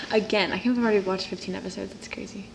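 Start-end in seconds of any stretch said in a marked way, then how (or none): none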